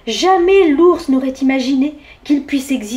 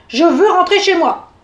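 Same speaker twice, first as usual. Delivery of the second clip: vexed demand; 5 semitones higher, 5 dB louder than usual. A narrator's reading. Vexed bear demands to leave.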